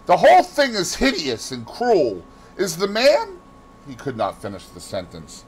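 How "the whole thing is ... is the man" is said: higher, louder, distressed protest; agitated, bitter outburst. A narrator's reading. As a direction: protest bitterly, then break off on a high question. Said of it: "he answered bitterly".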